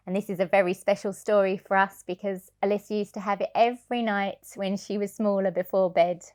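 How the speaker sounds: ordinary delivery, nothing marked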